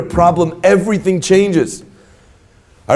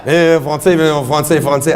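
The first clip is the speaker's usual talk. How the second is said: mock French; 3 semitones lower than usual. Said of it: "But the charade continued".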